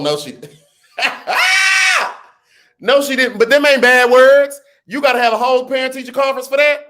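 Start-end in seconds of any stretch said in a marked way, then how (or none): 1.0-2.2 high shrieking burst of laughter. Real, not acted. Shocked by the daughter's words. 2.8-6.8 much higher and louder, incredulous; excited disbelief. Real, not acted. Reacting to the daughter's words.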